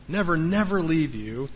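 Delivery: ordinary delivery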